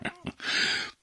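brief high breathy chuckle; a quick amused laugh; short laugh. A narrator's reading. Governor chuckling at the boy's trick, short laugh. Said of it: "حاکم خندهٔ کوتاهی کرد".

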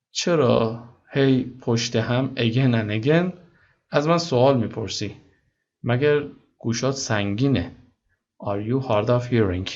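ordinary delivery

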